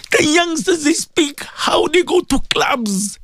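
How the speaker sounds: high, strained pleading to God; anguished confession. Real, not acted. Anguished confession of sins to Allah.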